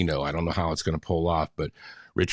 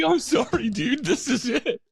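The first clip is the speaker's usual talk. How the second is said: very high, helpless retold protest; squeaky-high mock apology through laughter; laughing protest. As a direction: plead innocence while cracking up. Retold protest while cracking up.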